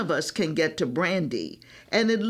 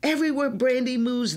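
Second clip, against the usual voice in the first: ordinary delivery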